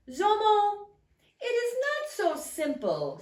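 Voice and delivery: high, booming god voice; lightly voiced sky god. A narrator's reading. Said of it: "said the sky god".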